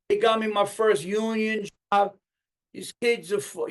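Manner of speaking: high, loud mock speech-giver; bad wedding speaker. Imitating a guy at a wedding, bad speech voice. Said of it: "I've seen some bad speeches".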